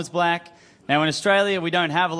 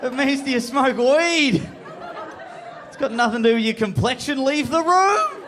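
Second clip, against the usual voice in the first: inner scolding; 11 semitones higher than usual. Retold or imitated. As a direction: yell at yourself like a frustrated inner voice. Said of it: "my brain's gone".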